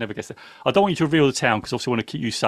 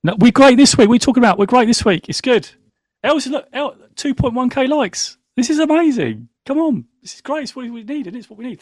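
tipsy excitement; 8 semitones higher than usual. Real, not acted. Tipsy host hyping the show.

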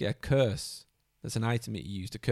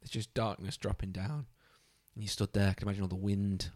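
ordinary talk

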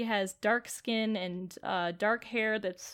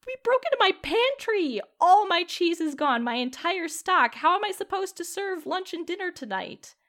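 upset outburst; 8 semitones higher, 4 dB louder than usual. Acted. Shopkeeper Millie upset about robbery.

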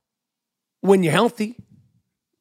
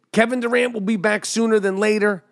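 usual speaking voice

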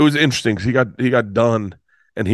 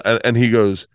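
ordinary delivery

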